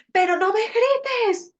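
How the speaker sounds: high, loud, drawn-out parental yell; mock angry yell. Hostile parent scolding a child who copies them, acted yelling. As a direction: yell it slowly, high and exasperated. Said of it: "Estás gritando".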